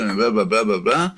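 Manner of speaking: normal talking voice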